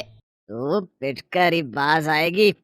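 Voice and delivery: slow, low slurred menace; drunk threat. Acted. Drunk father menacing his wife before beating her, slow and low.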